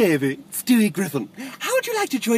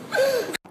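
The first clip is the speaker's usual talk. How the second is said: shocked gasp. Acted.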